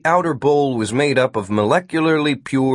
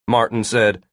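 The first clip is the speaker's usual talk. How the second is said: ordinary delivery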